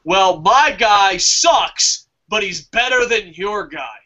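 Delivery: slow, drawn-out grudging concession; slow grudging voter. Retold or imitated. Partisan voter defending his candidate, slow grudging tone. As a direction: drag out the first half reluctantly. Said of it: "There's always this feeling".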